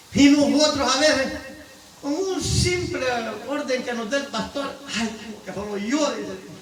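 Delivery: loud, high, rousing sermon voice; full-force preacher calling the flock to God; fiery preaching. Acted. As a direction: preach it out to the whole church.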